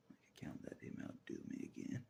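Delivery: unvoiced whisper. Real, not acted. Whispering about not letting Jesus outdo him. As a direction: say it under your breath.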